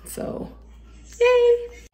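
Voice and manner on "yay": high, loud little celebration; high-pitched, delighted whoop; happy cheer. Real, not acted. Celebrates finding lost magnets.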